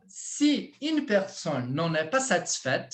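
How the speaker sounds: frase modelo lenta e articulada; modelo calmo. Shown as a demonstration. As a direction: mostre a leitura devagar, para o aluno repetir. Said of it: "Calma, ó". (leitura pausada de professor)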